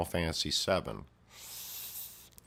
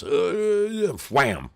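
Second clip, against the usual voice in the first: louder, higher burst on the slam; excited wham; 8 semitones higher, 7 dB louder, slower than usual. Real, not acted. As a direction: punch out the impact. Narrator acting out a head slam.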